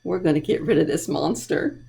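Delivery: ordinary delivery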